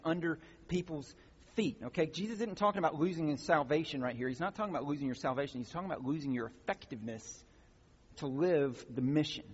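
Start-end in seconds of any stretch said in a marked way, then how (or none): none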